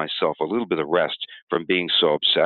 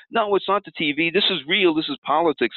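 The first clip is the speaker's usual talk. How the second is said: much higher, indignant insistence; a higher, insistent rebuttal; indignant protest; 9 semitones higher than usual. Shown as a demonstration. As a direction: push back hard, pitch up. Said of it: "You're gonna say things like".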